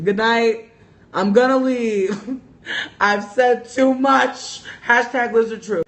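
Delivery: hammed-up embarrassed goodbye; mock exit. Real, not acted. Joking exit after overshare.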